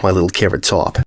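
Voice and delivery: mother saying goodbye to her daughter with affection; tender farewell. Acted. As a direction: let the pet name settle gently.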